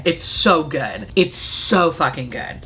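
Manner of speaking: drawn out, very slow and low; slow, indulgent praise; slow savoring. Real, not acted. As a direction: linger on every word. Savoring the palette.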